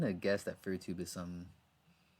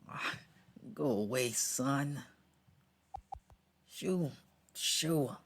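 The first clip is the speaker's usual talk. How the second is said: high, playful shoo; 5 semitones higher, much slower than usual. Acted. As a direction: shoo it off in a lilting voice. Shooing away the sun in character.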